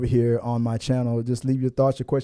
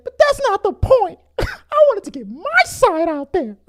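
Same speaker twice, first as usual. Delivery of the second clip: mock Colleen; 5 dB louder than usual. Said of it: "She's like".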